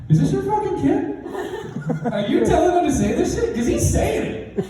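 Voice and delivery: high-pitched mock outrage; outraged principal. Principal phoning the stepdad to complain, outraged. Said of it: "something along the lines of".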